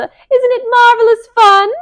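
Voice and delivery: very high, loud delighted squeal; thrilled city mouse shouting; excited squeal. Acted. Shouting over traffic.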